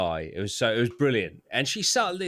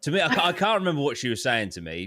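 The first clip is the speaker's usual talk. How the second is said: usual speaking voice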